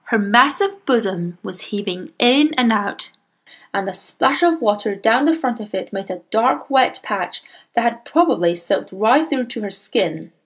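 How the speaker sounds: ordinary delivery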